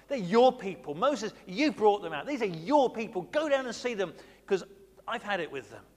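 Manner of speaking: loud, stressing your; God disowns the people in anger; exasperated God. Retold or imitated. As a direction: push the people back onto Moses.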